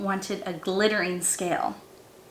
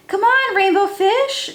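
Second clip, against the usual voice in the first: high, louder call from the fish friends; 8 semitones higher, 6 dB louder than usual. A narrator's reading. Fish friends calling rainbow fish to play, high and louder. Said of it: "they called".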